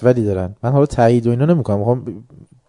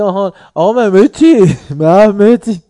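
high, loud, slow mock drawl of "مهدی"; hammed-up imitation stretching out "آقا مهدی"; 8 semitones higher, 8 dB louder than usual. Mocking someone saying the name.

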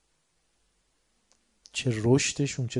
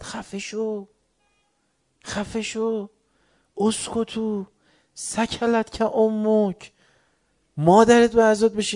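higher, sharp retold shout of shut up; angry rebuke; 8 semitones higher, slower than usual. Ali retold rebuking the brash man, angry. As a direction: scold him hard, slowing mid-way. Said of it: "حضرت داد زد".

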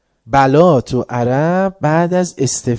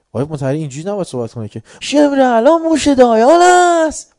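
higher, louder mock protest; mock indignant complaint; 11 semitones higher, 7 dB louder than usual. Listeners voicing a mock complaint about the harsh tone. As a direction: protest in alarm that he talks too harshly.

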